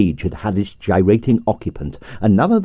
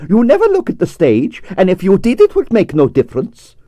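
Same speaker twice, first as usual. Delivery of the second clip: indignant dancer; 9 semitones higher than usual. Acted. The Moor reproaching conductor.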